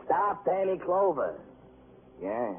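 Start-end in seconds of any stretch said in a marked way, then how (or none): 0.1-1.4 high-pitched yell for show; mock yell. Prisoner showing detective how he'd yell, mock yell. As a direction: demonstrate a yell, pitched well up. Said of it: "I can yell".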